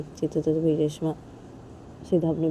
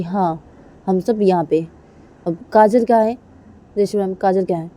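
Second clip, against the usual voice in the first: angry friend Nidhi; 2 semitones higher, 7 dB louder than usual. Acted. Nidhi demands Reshma tell where Kajal is, angry and loud. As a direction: confront the mother-in-law sharply.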